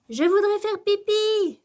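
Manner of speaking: put-on high, loud little girl's outburst; Lily s'exclame. A narrator's reading. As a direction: blurt it out in a high child's voice. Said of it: "s'exclame soudain Lily". (exclamation soudaine de petite fille)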